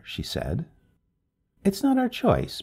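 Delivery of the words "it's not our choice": wry, pitched high; a dry little touch of irony up high. A narrator's reading. Wife answers neighbor's talk of knives, wryly. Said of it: "Missus Smith said wryly".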